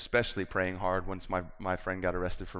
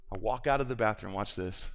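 ordinary talk, nothing marked

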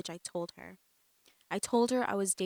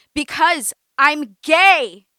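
loud, much higher, slow scream; 7 semitones higher, 17 dB louder, much slower than usual. A narrator's reading. Amanda screams her secret at her cousin and aunt, full force. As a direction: finally scream the truth. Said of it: "I screamed".

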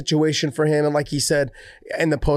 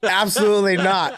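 laughing refusal; 4 semitones higher than usual. Real, not acted.

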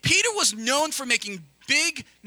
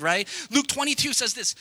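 normal talking voice

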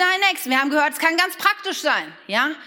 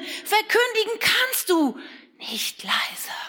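whisper; 7 semitones higher, much slower than usual. Acted. Whispers that proclaiming can't be quiet.

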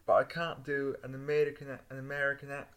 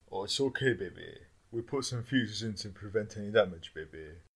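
seductive voice; 7 semitones lower than usual. Acted. Sultry lover voice.

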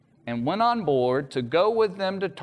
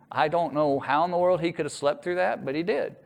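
usual speaking voice

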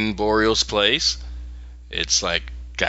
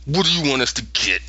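mock grudging agreement, higher at the start; grudging, through gritted teeth; 7 semitones higher than usual. The kids cornered into a deal, grudgingly. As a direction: give in through gritted teeth, imitating them. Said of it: "they gotta grit their teeth".

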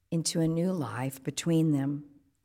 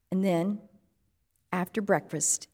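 ordinary delivery

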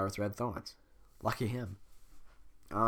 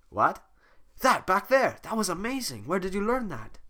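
excited Donkey voice; 8 semitones higher, 4 dB louder than usual. Acted. Donkey asking Fiona amazed.

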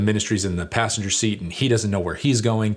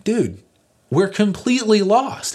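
much higher, louder mock despair; exasperated; 12 semitones higher, 4 dB louder than usual. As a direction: throw up your hands.

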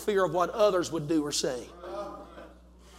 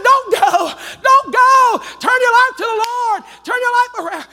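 urgent shouted warning; 9 dB louder than usual. Acted. Man at cliff edge calling to people, urgent shout. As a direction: shout it out like a desperate preacher's plea.

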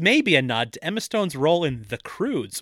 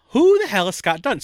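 raised, louder baffled question; baffled outburst; 8 semitones higher, 6 dB louder than usual. Retold or imitated. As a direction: ask it genuinely stumped. Retold own confusion.

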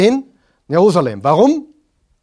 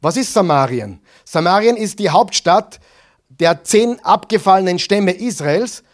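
ordinary talk

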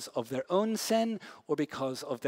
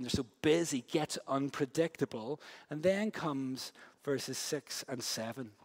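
usual speaking voice

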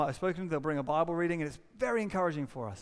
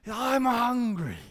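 imitated, slightly toned-down yell; slow, raised-voice echo of a kid's yell; child's shout; 4 semitones higher, 5 dB louder, much slower than usual. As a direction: draw it out high and loud like a demanding child. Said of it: "started shouting at me".

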